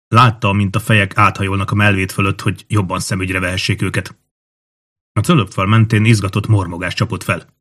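ordinary delivery

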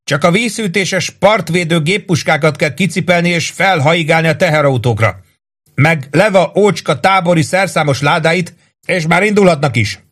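sustained high-pitched yell; narrated shout, pitch held high; hollering. A narrator's reading. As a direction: holler a string of orders down from above. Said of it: "üvöltötte".

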